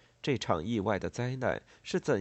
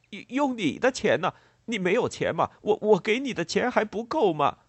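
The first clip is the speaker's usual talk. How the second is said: loud, high-pitched stammering questions; angry demand; 11 semitones higher, 7 dB louder than usual. A narrator's reading. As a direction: demand answers in rising agitation. Farmer angrily demands his money. (激动愤怒地质问)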